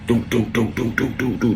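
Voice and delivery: rapid run of "doo" gunshots; imitated gunfire. Storyteller imitates shots ringing out, vocal gunfire. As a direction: mimic repeated shots with the voice.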